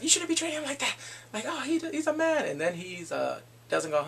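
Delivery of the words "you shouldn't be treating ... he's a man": high-pitched scolding imitation; mock arguing. Two moms fighting over a husband, mock squabbling. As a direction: bicker in a raised, higher voice. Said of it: "they're fighting over".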